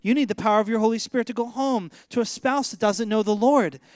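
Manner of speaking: normal talking voice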